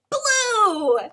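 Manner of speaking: high, loud answer shout; reveal. Acted.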